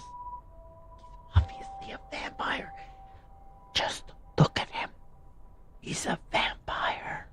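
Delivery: slow conspiratorial whisper. Real, not acted. Gamer warns viewers about the priest in a slow whisper. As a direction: hush it like a secret.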